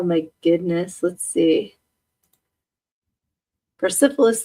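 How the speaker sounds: ordinary talk, nothing marked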